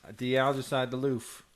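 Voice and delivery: "loof" said with an L in place of the R in roof, held up as a playful slip; "de-algicide the loof" repeated deliberately, with "loof" for "roof", as if in a foreign tongue; mock foreign loof. Friend repeats his own slip on purpose, saying "loof" for "roof". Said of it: "sounds like I'm speaking a different language".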